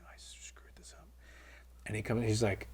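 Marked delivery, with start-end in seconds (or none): 0.1-1.1 very quiet, whispered to himself; hushed private admission of a blunder; dismayed aside. Retold or imitated. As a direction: murmur it under your breath, retelling a sinking thought. Inner dread as guard approaches.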